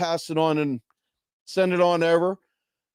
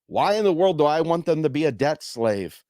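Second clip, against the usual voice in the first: ordinary talk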